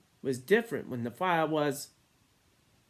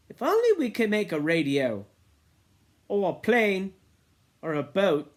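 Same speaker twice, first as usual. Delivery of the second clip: put-on boy's wishful voice; 6 semitones higher, 7 dB louder than usual. A narrator's reading. Boys voiced in novel reading.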